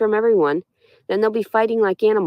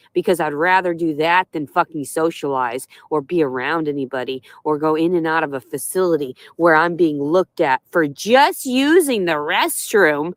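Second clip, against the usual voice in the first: ordinary talk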